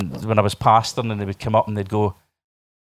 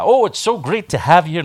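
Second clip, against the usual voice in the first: higher, effusive interviewer voice; gushing interviewer; 5 semitones higher, 4 dB louder than usual. Acted. Interviewer greeting a freed prisoner, gushing. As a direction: welcome the guest with put-on enthusiasm. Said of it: "the guy was like".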